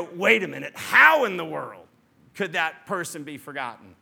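loud, disbelieving wait a minute; incredulous outburst. Retold or imitated. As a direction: burst out in disbelief. Voicing a nursing parent.

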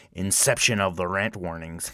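ordinary talk

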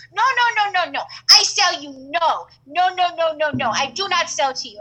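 high, loud rapid refusals in broken English; mock shopkeeper shouting no at the customer; hammed-up accented refusal; imitating shopkeeper's accent. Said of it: "She goes".

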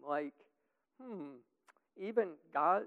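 ordinary talk, nothing marked

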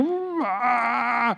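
long, high strained "aah"; frustrated groan. Acted. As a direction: let out a drawn-out flustered cry. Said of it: "you can get frustrated and flustered".

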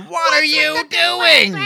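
high, slow, shrieked what are you doing; mock shocked woman. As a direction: squeal it like a startled lady. Retelling the woman's shocked reply.

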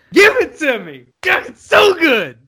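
loud, high-pitched exclamation of delight; excited gushing. Real, not acted. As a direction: cry out, thrilled by a favourite scene. Gushing over favourite kill scene.

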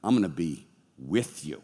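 gentle God voice. Acted. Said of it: "He's like".

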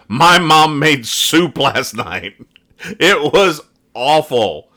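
hammed-up Dennis announcing the soup; mock kid voice. Dennis blurting to Margaret about mom's soup, slow and laughing.

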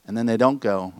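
usual speaking voice

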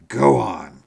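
put-on a loud excited cry from the old soldier. A narrator's reading. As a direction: call it out in ecstasy. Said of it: "cried my military friend".

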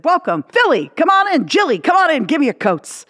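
a showman host greeting guests at the door; imitating Regis. Host Regis welcoming Jilly and Philly, high and bright.

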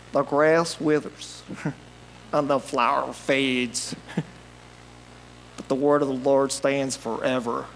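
Southern preacher. Retold or imitated. Imitating his Southern pastor reading the verse, slow and low. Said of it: "he sounded like this".

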